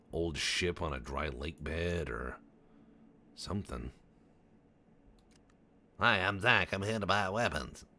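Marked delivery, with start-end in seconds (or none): 6.0-7.9 high, loud little-guy voice. Acted. Little guy announces he's shopping for weapons, high and loud. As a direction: pipe up loudly as a little character. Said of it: "'Cause he's little".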